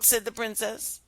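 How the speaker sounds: ordinary delivery, nothing marked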